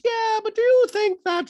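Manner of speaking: very high, loud falsetto; piercing put-on high voice; Dorit impression voice. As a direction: press the question in mock housewife falsetto.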